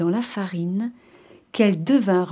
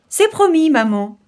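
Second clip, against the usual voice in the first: high, louder start falling to normal; kids' promise; 7 semitones higher, 4 dB louder than usual. Acted. Kids reassure mother goat before she leaves, high chorus. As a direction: promise mother eagerly, pitched up.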